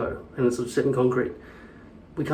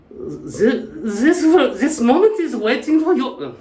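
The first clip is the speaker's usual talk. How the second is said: high-pitched mock German accent; 10 semitones higher, 3 dB louder, slower than usual. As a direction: answer as a caricature German, pitched way up. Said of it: "A Russian talking to a German".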